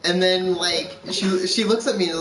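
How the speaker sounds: ordinary talk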